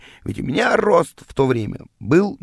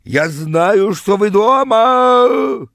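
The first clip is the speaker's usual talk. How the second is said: high, loud, drawn-out bellow; hysterical yell; 8 semitones higher, 6 dB louder, much slower than usual. A narrator's reading. Rival beating on the door, hysterical yelling. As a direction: scream it at the door, stretching the last word. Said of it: "он бился в истерике и в дверь".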